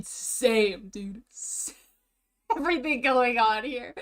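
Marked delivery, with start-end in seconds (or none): 2.1-3.9 laughing. Real, not acted. Laughing at the clip.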